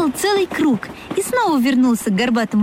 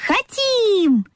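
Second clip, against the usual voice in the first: high, drawn-out gleeful cry; eager agreement; 11 semitones higher than usual. A narrator's reading. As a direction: answer like a crowd of little animals. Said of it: "хором согласились зверята".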